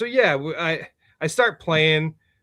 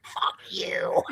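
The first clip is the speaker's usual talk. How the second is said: whispered; 6 dB quieter than usual. Real, not acted. Whispered at friend, holding back tears.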